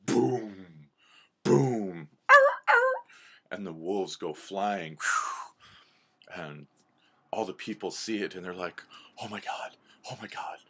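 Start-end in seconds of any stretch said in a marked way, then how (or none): 0.1-2.1 loud, drawn-out booms; two heavy cartoon blows; booming impact noise. Imitates elk knocking wolves away. 9.2-10.6 hushed, airy disbelief; half-whispered shock; stunned onlookers. Acted. Onlookers stunned by wolves' defeat.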